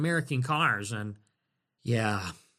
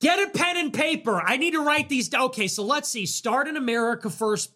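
sarcastic eager; 6 semitones higher than usual. Acted. Sarcastic note taking.

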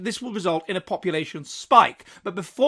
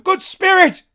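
high, loud imploring call; Scrooge pleading; 13 semitones higher, 10 dB louder, slower than usual. Acted. Scrooge falling before the spirit, loud plea. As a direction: appeal to the spirit's kindness. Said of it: "he pursued".

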